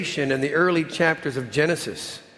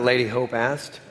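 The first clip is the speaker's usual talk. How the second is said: ordinary delivery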